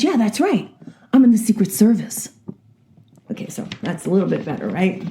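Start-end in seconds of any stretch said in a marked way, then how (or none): 0.0-2.3 high put-on boy voice; lightly hammed-up youngster delivering the punchline; eighteen-year-old boy. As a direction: pitch it up for a cheeky comeback. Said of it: "eighteen-year-old boy by the neck".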